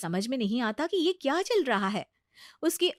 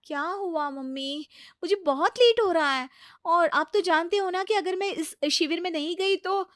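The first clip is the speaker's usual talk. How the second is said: hurried irritation; 6 semitones higher, 3 dB louder than usual. Acted. Priti rushing out, irritated.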